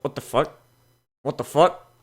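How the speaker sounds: normal talking voice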